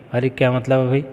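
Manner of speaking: normal talking voice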